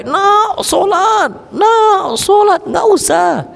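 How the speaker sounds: put-on high, shouted nagging at a child; shrill-free mock shout of an exasperated parent; mock parent yelling. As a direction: bark the order to pray, then wave it off.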